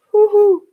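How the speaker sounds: high hooting owl call; hooting like a night owl. Acted. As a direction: hoot it like an owl. Owl noise.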